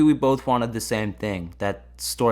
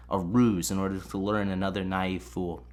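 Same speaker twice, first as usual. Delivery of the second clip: normal talking voice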